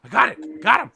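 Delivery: high, frantic, excited dog; Rufus. Acted. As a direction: yelp in a rush of excitement. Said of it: "Rufus is just like".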